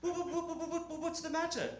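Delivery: high, stuttering, fussing mum; concerned British mother. Retold or imitated. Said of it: "My mother comes in".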